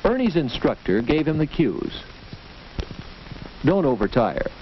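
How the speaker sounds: ordinary talk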